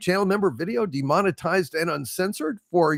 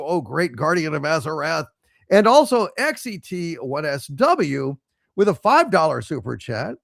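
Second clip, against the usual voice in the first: ordinary talk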